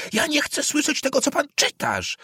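high-pitched shout with held-back volume. A narrator's reading. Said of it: "krzyknął na cały głos". (wysoki okrzyk protestu)

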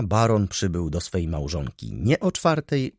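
usual speaking voice

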